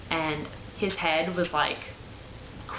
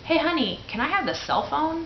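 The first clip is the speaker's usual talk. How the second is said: high, sugary wheedle; sweet asking voice; 10 semitones higher than usual. Retold or imitated. Wife sweetly asking husband for the phone, high and coaxing. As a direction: coax him sweetly for the phone.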